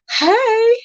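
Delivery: high bright greeting; sing-song hello. Real, not acted. Streamer greeting a viewer, sing-song hi. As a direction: call out a high sweet hey.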